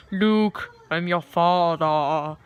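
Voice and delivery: heavy exhale, then slow, drawn-out villain line; mock film villain. Woman on nitrous oxide playing with the mask, mock villain voice. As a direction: breathe out, then intone it slowly like a masked villain.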